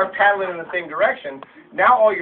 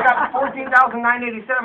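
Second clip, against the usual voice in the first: laughing; 5 semitones higher, 7 dB louder than usual. Retold or imitated. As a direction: chuckle out the near miss. Laughing retelling near miss.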